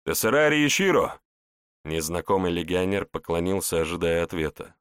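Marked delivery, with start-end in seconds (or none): 0.0-1.2 calling out. Acted. Legionary calls out to mage.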